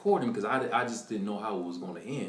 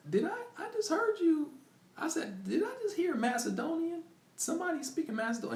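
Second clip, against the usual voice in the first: eager question. Retold or imitated. Asking the nurse, thrilled.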